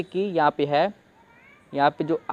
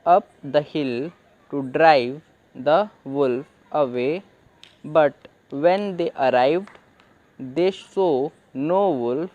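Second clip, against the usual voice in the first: slow reading aloud; much slower than usual. Shown as a demonstration. Teacher reading the story sentence to students, very slowly. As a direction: read the English passage very slowly. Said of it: "इसे हम तोड़ तोड़ के पढ़ लेते हैं".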